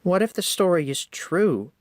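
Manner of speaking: high worried little engine voice; Percy, worried. A narrator's reading. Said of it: "Percy asked".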